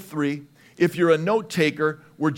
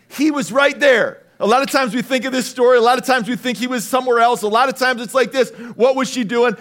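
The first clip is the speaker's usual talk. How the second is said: fast, high, loud preacher build; preaching at full force; 6 semitones higher, 5 dB louder, faster than usual. Acted. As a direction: drive the point home to the congregation.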